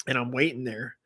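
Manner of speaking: normal talking voice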